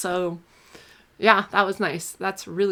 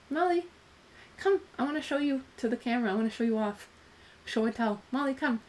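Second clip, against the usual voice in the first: higher, softer coaxing of a pet; calling the dog; 4 semitones higher, 5 dB quieter than usual. Real, not acted. Calling her dog Molly to the camera, soft and higher. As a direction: sweetly coax the dog over.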